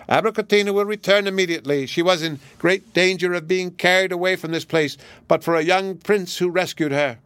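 high, quick chattering parrot. Acted. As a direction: rattle it off like a pet bird. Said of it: "assumed the tone of a parrot".

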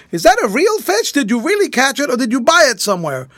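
high-pitched shouted questions, easing slightly; calling across. A narrator's reading. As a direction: holler questions over the water, starting very high. Still calling to the other boat.

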